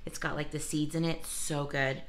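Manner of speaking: ordinary talk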